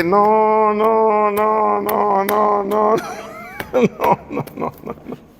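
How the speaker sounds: high laughing no, no, no fading to a breathy giggle; laughing protest. Retold or imitated. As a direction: protest through laughter, then lose the voice to it. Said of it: "Le dije".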